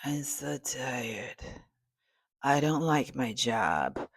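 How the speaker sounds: slow, drained whisper of a worn-out employee; tired zombie worker. Retold or imitated. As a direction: drag the words out like a corporate zombie. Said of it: "walking around like zombies".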